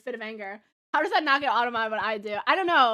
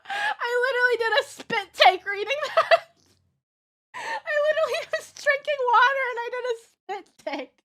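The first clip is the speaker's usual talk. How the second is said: higher, laughing through the words; laughing hard; 10 semitones higher, 3 dB louder than usual. Real, not acted. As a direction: keep cracking up while telling it. Laughing about spit take.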